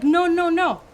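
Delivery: hammed-up worker brushing it off; excited denial. Worker correcting homeowner, a touch excited.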